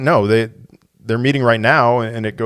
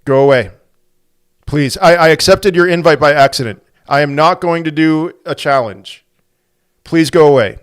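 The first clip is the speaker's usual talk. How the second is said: louder, repeated firm go away; insistent go away; 4 semitones higher, 6 dB louder than usual. Real, not acted. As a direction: keep pushing the challenger off. Repeatedly tells challenger to go away.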